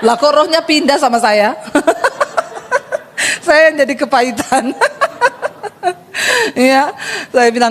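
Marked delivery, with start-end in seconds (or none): 1.6-3.4 high unvoiced giggle; breathy high laugh. Real, not acted. Laughs at own joke. 4.7-5.9 high giggle, falling off; high laugh. Real, not acted. Laughs at own joke.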